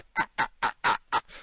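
imitated cackling of the pranksters; put-on giggling fit; mock laughter. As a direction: laugh in mockery, a long rattling run.

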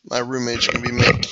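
usual speaking voice

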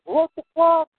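high, startled swear; shocked outburst. Retold or imitated. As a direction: blurt it out in disbelief. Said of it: "I'm like".

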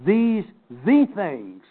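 slow, deliberate weight on "These" and "the"; "These... the things", spaced out for emphasis; emphatic. Shown as a demonstration. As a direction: stretch "These the things" out, stressing each word. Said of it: "That's how it would be read".

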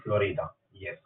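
normal talking voice

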